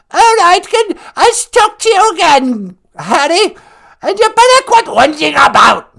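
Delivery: loud, high, scolding British grandmother; hammed-up royal indignation; Queen Mum. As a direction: scold like a cranky comic monarch. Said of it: "It's the Queen Mum".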